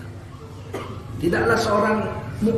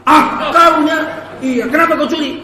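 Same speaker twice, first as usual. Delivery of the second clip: loud, higher, startled shout; shocked outburst; 8 semitones higher, 8 dB louder than usual. Acted. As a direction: bark it out in disbelief. Owner shocked by thief's confession. (teriakan kaget, keras dan tinggi)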